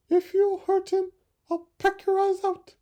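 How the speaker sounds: very high, loud fierce threat; desperate mother bird threat. Acted. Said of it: "cried the poor mother".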